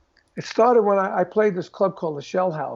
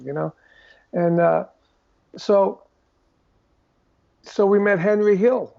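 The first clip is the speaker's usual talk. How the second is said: usual speaking voice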